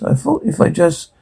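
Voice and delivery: soft, under-the-breath mutter. A narrator's reading. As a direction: mumble it quietly to yourself.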